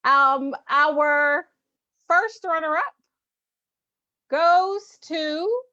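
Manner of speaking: high, bright announcer build-up; drawn-out pageant host reveal. Acted. Announces awards in raised voice.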